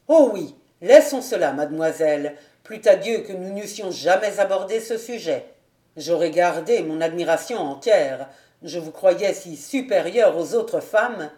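the hurt captain echoing laissons cela with bitterness; bitter echo. Acted. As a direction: throw her words back at her, loud. Didier bitterly echoes her.

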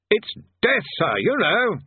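high and loud to a deaf man. A narrator's reading. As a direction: speak up for a deaf old man. Bursar raising voice for deaf man.

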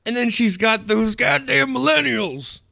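high-pitched mock old-lady rant; grumbling old lady. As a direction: put on a cranky old woman's high voice. Mimics old lady grumbling about millennials.

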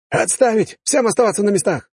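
high, loud halt order; shouting. Acted. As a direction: stop the whole parade. Captain halts the parade.

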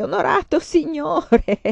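high, slow, hammed-up moaning plea; feigned groan of pain. A narrator's reading. Farmer faking illness to the guard, mock groaning plea. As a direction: ham up a bellyache to fool the soldier. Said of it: "si piegò in due e gemette".

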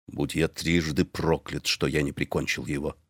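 grim husband, under his breath; Hans muttering. A narrator's reading. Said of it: "последовал ответ".

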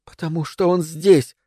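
high whisper, broken by gasps; frightened breathless whisper. A narrator's reading. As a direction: whisper between scared breaths. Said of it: "сказала она в паузах, испуганно переводя дыхание".

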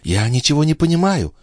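high voiced aside; puzzled lady's aside, not truly whispered; whispering countess. A narrator's reading. Countess aside to Balsamo, high, puzzled stage whisper. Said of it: "шепнула госпожа Дюбари Бальзамо".

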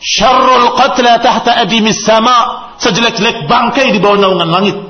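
put-on high, loud and slow, a stern old companion declaring it; Abu Umamah's loud answer. Speaking to his companion, Abu Umamah loudly and slowly condemns the heads. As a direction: proclaim each word slowly, like a stern verdict. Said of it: "Yang mengantarnya berkata".

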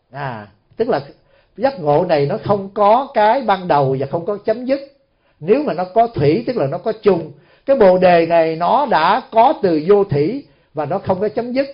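usual speaking voice

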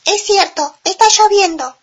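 high little chick voice. A narrator's reading. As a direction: squeak it like a tiny chick. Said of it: "dijo el pollito".

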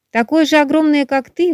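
high, eager and amazed; excited high voice. A narrator's reading. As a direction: ask with wide-eyed delight. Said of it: "восторженно спросил мухоморчик".

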